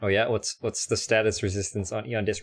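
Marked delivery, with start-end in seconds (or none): none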